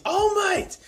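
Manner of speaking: high-pitched mock yell, drawn out; imitated scream. A fan imitating the hero running to his idol, screaming. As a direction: cry it out high, like an anime hero. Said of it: "he sc-, just screams".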